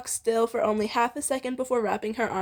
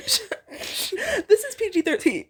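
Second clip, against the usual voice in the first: laughing; 9 semitones higher, slower than usual. Real, not acted. Joking about the story's rating.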